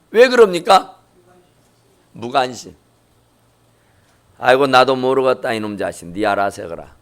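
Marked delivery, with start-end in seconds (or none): 4.4-6.9 muttered, much softer, fast sigh of a parent washing his hands; a hint of a weary father giving up on his son. Preacher voicing a parent who stops caring, resigned and soft. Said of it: "이렇게 돼버려지는 않았을까".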